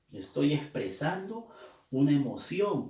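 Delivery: ordinary talk, nothing marked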